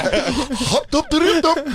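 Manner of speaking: high staccato imitated kick cry; video-game kick noises. Imitating a Street Fighter spinning kick, high comic chant. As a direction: chirp out a mock spinning-kick sound. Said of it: "that kick where they go around".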